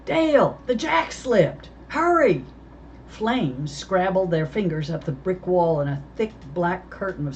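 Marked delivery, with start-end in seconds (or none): 0.1-2.4 high, loud, urgent cries; pinned under a car, shouting for help; desperate shout. Acted. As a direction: yell for help, panicked. Trapped man calling for help.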